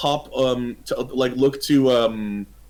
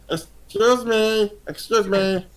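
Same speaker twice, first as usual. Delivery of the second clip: high, drawn-out little voice; small creature's plea; 8 semitones higher, slower than usual. Acted. Small creature tugging at the orc's sleeve, high polite plea. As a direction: politely pipe up for attention, higher.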